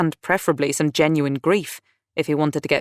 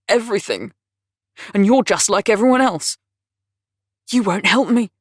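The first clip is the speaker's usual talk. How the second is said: bitter accusation; 6 semitones higher, 5 dB louder than usual. A narrator's reading. Dudley accusing Lizzie.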